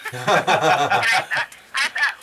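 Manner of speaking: laughing as she starts the line; amused laugh in the voice. Real, not acted. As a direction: chuckle through it. Laughing at her own story.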